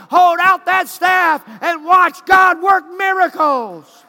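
loud climbing shouted sermon; preaching at full force. Acted. Preacher rousing the congregation with shouted commands. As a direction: build the crowd up, shouting each line.

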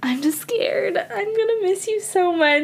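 saying goodbye to a best friend, choked up; tearful goodbye. Real, not acted. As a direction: voice catching, close to tears. Friend leaving after graduation.

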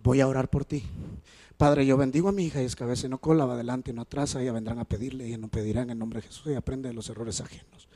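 soft, low blessing prayer; father praying a blessing over daughter. Retold or imitated. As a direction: pray quietly over the child. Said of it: "Número cuatro".

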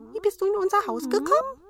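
hohe, schnelle Zwergenstimme; Zwerge fragen. A narrator's reading. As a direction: neugierig hoch fragen. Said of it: "sprachen weiter die Zwerge". (hohe, flinke Zwergenstimme)

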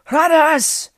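high, loud scream of the name. A narrator's reading. Wounded knight screams the king's name, high and loud. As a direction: fling the name across the field. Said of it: "he screamed".

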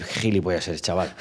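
low, soft, lightly voiced gruff emperor; gruff father. Vespasiano scolds his son Tito in a low mock growl. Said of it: "viene a decir".

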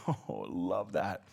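usual speaking voice

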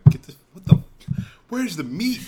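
high, half-laughing exclamation; hammed-up indignant outburst; mock outrage. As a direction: demand the meat in disbelief. Imagined outburst at pasta dinner.

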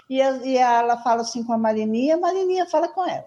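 ordinary talk, nothing marked

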